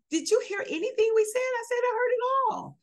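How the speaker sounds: high, mock-indignant exchange with the doctor; heated exchange. Patient retelling the argument with her doctor, high and exasperated. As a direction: pitch it up high, half-joking indignation.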